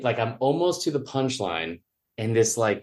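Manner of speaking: ordinary delivery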